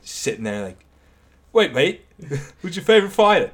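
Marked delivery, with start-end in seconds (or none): 1.5-3.5 put-on high, loud Australian accent, laughing; Australian accent, fast and amused. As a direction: play Whitaker in an Aussie accent. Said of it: "I like the Australian accent".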